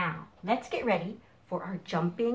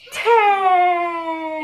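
shouted number; 12 semitones higher, 12 dB louder than usual. Real, not acted. Final count in jumping song.

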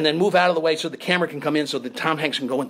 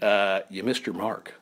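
retold low, softer director's note; understated, lowered quoted voice; low quiet correction; 8 semitones lower, 5 dB quieter than usual. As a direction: quietly point out the mistake, low.

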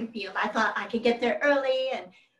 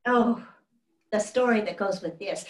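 ordinary delivery

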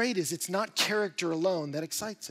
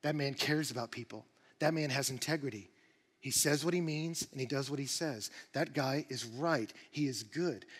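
admiring murmur; 4 semitones lower, 5 dB quieter than usual. Retold or imitated. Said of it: "they go".

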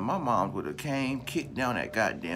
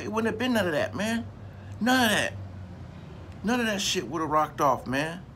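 emphatic outburst; 9 semitones higher than usual. Real, not acted. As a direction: slam it down with full conviction.

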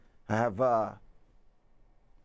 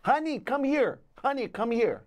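calling honey; 12 semitones higher than usual. Pretend. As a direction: call your partner from the couch. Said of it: "you have to say".

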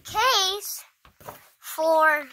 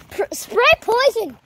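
panicked shout; 6 semitones higher, 5 dB louder than usual. Real, not acted. Panicked call during spider scare.

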